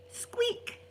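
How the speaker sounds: high whispered squeak; squeaky imitation. Gran copies her eye's squeak, high and whispered. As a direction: mimic a tiny squeaking noise.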